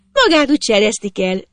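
put-on high girl's voice; teasing girl. A narrator's reading. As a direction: tease the boy in a high-pitched voice. Girl teases the boy from below.

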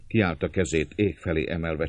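usual speaking voice

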